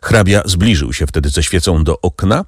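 ordinary delivery

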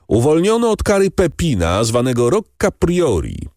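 high, carrying public announcement; oficjalne oznajmienie. A narrator's reading. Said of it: "oznajmił starszy bractwa". (uroczyste ogłoszenie podniesionym głosem)

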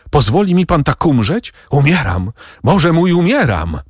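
high-pitched, acted pleading; desperate cry. A narrator's reading. Dying Barrois begs the doctor for help, desperate high cry. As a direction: cry out as a dying man, without real shouting. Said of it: "krzyczał Barrois".

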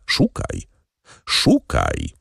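put-on a low, ominous count taunting his old enemy; low menacing count. A narrator's reading. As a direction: press him to remember, low and grim.

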